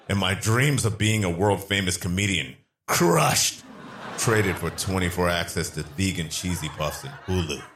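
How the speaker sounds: usual speaking voice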